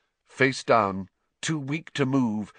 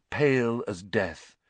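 plain reading voice

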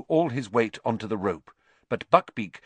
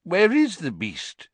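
higher, drawn-out reedy demand; 8 semitones higher, 3 dB louder than usual. Acted. Committee member hunting the missing beast, reedy indignant voice. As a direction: slowly demand it as a reedy official. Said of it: "said the reedy voice of the committee member".

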